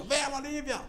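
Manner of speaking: high mock cartoon bully call; Brutus calling. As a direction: ham up the cartoon brute's come-on. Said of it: "aí Brutus encostava". (Brutus chamando a Olivia, imitação exagerada)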